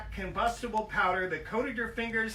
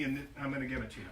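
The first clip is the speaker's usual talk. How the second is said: throwaway aside; 8 semitones lower, 8 dB quieter, much faster than usual. Acted. Mock aside to kids.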